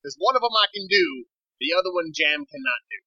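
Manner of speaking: intense woman dealer. Acted. GM plays Missus Jam.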